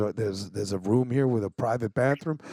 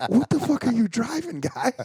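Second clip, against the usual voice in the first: incredulous yell; 9 semitones higher than usual. Retold or imitated. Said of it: "And I'm like".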